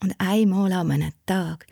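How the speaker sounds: plain reading voice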